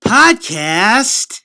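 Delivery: loud, high-pitched show announcer; hyped podcast intro. Acted. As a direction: boom out a grand welcome to the show. Opens show as hammed-up host announcer.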